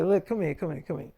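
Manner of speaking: soft, low aside, dropping away; hushed coaxing. Retold or imitated. Boyfriend pulling girlfriend aside to calm her, hushed coaxing. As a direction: quietly draw her in close.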